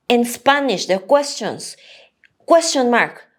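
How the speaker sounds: usual speaking voice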